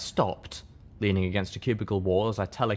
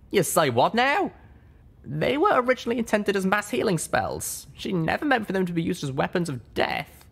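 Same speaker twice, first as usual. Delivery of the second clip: higher voiced exchange; character dialogue; 11 semitones higher than usual.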